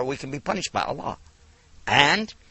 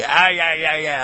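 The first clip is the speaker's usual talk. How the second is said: antagonistic; 5 dB louder than usual. Shown as a demonstration. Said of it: "in a harsh and antagonistic way".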